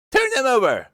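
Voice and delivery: high, loud angry command; angry queen. Acted. Queen ordering the Knave, angry and loud. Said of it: "turned angrily away from him".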